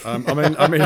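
laughing as he starts; chuckling through the words. Real, not acted. Laughs at question.

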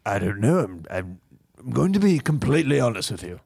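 hesitant, affected confession; pompous rich bachelor. Acted. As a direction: confide like a self-important millionaire.

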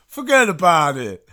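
put-on slow, loud hammy Italian mobster accent; overdone Italian accent, drawn out; bad Italian accent. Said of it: "world's worst Italian accent".

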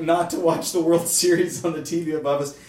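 ordinary talk

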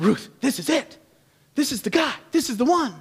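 excited Naomi. Retold or imitated. Naomi telling Ruth, excited. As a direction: insist it's him with glee. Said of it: "She's like".

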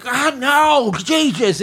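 a hint of the drunk caller giving up; exasperated drunk guy. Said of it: "the guy's like".

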